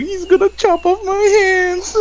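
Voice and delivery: very high, loud wailing accusation; crying child. Acted. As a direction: bawl it out for onlookers to hear. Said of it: "crying and just pointing".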